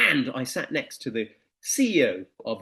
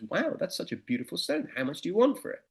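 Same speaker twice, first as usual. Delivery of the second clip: lightly voiced lady buyer; admiring lady; 5 semitones higher than usual. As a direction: marvel at the stone like a shopper. Lady at market stall.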